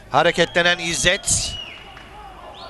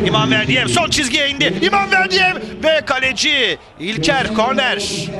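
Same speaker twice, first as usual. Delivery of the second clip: excited commentary; 6 semitones higher than usual. Real, not acted. Rising attack, commentator calls it.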